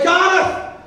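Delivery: high, drawn-out shout across the bar; hammed-up Welsh tourist yelling; excited Welshman. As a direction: bellow to a mate in thrilled disbelief. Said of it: "You're a Welshman in an Irish bar".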